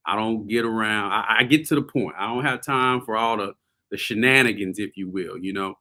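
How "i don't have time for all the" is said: normal talking voice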